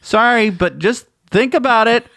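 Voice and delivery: high, loud calling out to listeners. Acted. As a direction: shout to wake up the sleepy listener.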